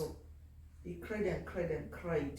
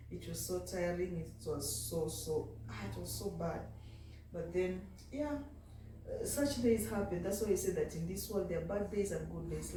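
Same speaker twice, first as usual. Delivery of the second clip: ordinary talk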